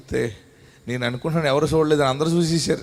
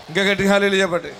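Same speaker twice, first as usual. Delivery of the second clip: గట్టిగా pushed louder, fast call; 5 semitones higher, 4 dB louder, faster than usual. Shown as a demonstration. Pastor urging the congregation to shout louder, louder call. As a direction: rouse the crowd with గట్టిగా.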